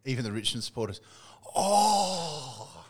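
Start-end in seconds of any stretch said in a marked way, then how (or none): 1.5-2.8 loud, high collective "Oh!" of awe; crowd's gasping cry. Retold or imitated. Fan recalling the crowd's roar at a huge kick, loud cry. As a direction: cry out like a whole stadium at once. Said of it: "the crowd just in, out in unison".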